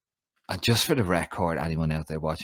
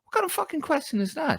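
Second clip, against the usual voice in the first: exasperated. Real, not acted. Outraged at interviewer's question.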